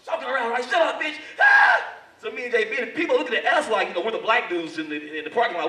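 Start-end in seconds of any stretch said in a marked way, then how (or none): 0.6-1.2 raised, high mock bullying shout; acted angry yell. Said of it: "He's like". 1.4-1.8 very high, loud shriek; mock scream of a woman being choked; imitated scream.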